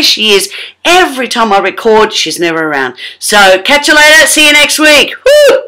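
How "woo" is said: high, loud whoop; very high whoop at sign-off; cheer. Real, not acted. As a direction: let out a happy whoop.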